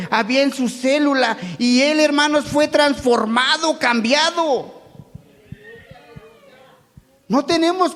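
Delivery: fervent sermon climax; preaching. Acted. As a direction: drive the point home to the congregation.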